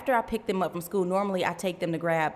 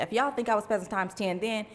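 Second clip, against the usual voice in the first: normal talking voice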